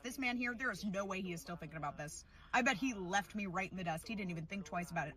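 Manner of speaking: ordinary talk, nothing marked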